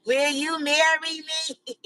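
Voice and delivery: slow, high pleading proposal; lightly voiced girlfriend proposing on one knee; mock proposal.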